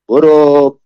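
loud, sharp brush-off; a snapped go away; angry retort. A narrator's reading. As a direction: shove him off with the word. Alaviyeh snaps back at guard.

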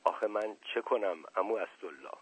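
soft, helpless plea; distressed and helpless. A narrator's reading. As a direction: ask quietly, at a loss. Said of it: "واقعاً پریشان و درمانده گفتم".

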